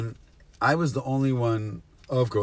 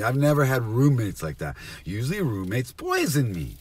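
normal talking voice

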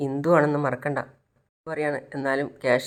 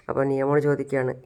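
plain reading voice

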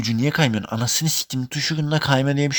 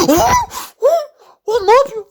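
sudden very high, loud anguished cries; shocked cry; 8 dB louder than usual. Real, not acted. Streamer sees his keeper blunder, real anguished yells. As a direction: yelp out in disbelief at the screen.

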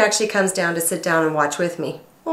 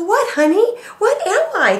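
mock innocent surprise; 14 semitones higher, 3 dB louder, slower than usual. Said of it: "And I go".

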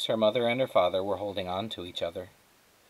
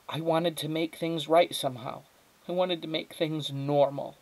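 high-voiced, earnest mother; pleading mother; 7 semitones higher than usual. Mother explains herself to Flora, pleading. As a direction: explain yourself in a raised pitch.

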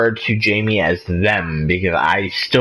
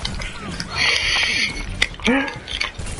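gasp; 12 semitones higher, 6 dB quieter than usual. Real, not acted. Reacts to orc dexterity joke.

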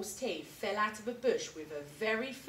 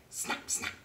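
whispered snap snap like jaws; snapping. Acted. The alligator snaps at the jungle, whispered snaps. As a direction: click the jaws shut, breathy and sharp.

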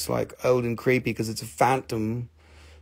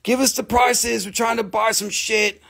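much higher, half-shouted slow demand to focus; mock yelling viewers; 9 semitones higher than usual. Impatient viewers telling him to show the rocks, mock shouting. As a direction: bellow like impatient buyers. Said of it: "They're all just like".

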